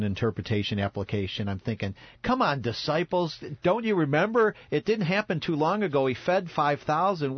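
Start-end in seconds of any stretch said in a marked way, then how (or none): 2.2-7.4 higher-pitched, incredulous mock scolding; exasperated chiding. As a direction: chide the forgetful disciples in disbelief. Imagined scolding of forgetful disciples.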